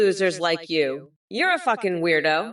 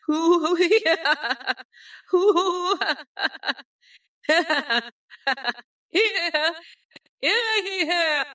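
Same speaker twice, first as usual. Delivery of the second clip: high cackle with whooping hoots; gloating cackle; 8 semitones higher, slower than usual. Pretend. Villain gloating over a threat to Trump's hamster, high cackle.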